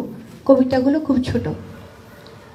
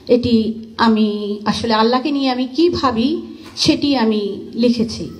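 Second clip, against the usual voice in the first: ordinary talk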